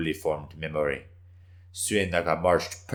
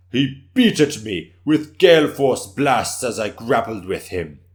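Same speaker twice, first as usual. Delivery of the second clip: boastful warrior; 5 semitones higher, 9 dB louder than usual. Acted. Boastful samurai telling his battle.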